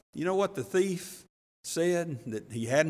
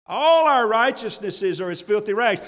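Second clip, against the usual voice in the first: louder, "All" punched at the start; emphatic repeat; 3 semitones higher, 4 dB louder than usual. Shown as a demonstration. Preacher repeating Isaiah to his flock, emphatic. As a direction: drive the verse home a second time. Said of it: "he had Isaiah sixty-four".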